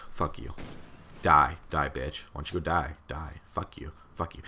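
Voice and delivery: low, quiet, uncaring deadpan; a hint of a bored cyclist, lightly voiced and flat; that exact tone. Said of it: "that exact tone".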